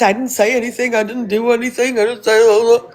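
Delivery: high, sing-song mock of his excuses; lightly voiced whiny denial. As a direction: mimic him playing innocent. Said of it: "you can go tomorrow and downplay it".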